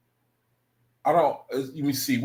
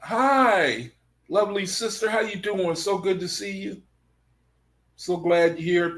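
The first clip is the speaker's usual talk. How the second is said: warm greeting; 6 semitones higher than usual. Real, not acted. Greeting viewer, still high.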